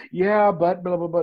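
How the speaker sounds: high loud yeah but, brushing it off; client's impatient yeah but; dismissive mock objection. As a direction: wave it away like a stubborn client. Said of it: "client objects".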